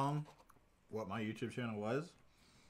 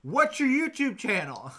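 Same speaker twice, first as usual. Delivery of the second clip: high, loud, drawn-out mock yell; imitated kids' yell; 11 semitones higher, 11 dB louder, slower than usual. Kids yelling at him through the windshield, imitated loud yell. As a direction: yell it slowly like demanding kids. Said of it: "yell at me".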